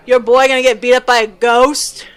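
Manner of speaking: loud and disbelieving; loud challenge. Acted. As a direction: call out a challenge across the room. Giant challenges the dwarf.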